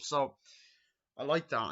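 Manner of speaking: normal talking voice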